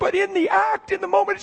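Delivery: high, pleading weepy voice; acted tearful confession; imitated tearful voice. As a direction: retell it high, as if crying. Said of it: "She's crying horribly".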